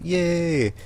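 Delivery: high squealed yay of triumph; happy cheer. Retold or imitated. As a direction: cheer it like you just won. Cheering at seven wins.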